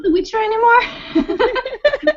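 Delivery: whiny laughing protest; laughing plea. Real, not acted. Friend begging topic change, laughing.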